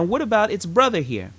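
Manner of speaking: ordinary talk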